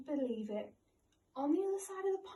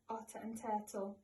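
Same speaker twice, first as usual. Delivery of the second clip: usual speaking voice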